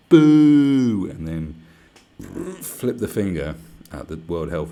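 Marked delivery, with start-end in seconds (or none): 0.1-1.1 long, loud, higher boo. Shown as a demonstration. Showing people how to boo China, loud and long. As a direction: jeer it out at full volume. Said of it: "Just go".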